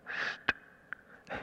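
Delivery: soft unvoiced shh; preacher showing the congregation his brother's signal; demo of a whispered shh. Shown as a demonstration. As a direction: hush sound, barely there. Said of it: "Can you hear that?".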